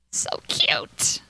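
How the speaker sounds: breathy whisper of adoration. Real, not acted. Gamer whispering over her cute potatoes. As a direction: whisper it to yourself, no voice.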